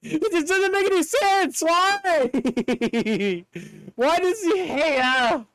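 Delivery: high, laughing disbelief; baffled outcry. Real, not acted. Gamer baffled that Starmie lost its cry, high laughing protest. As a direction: throw up your hands at the absurdity.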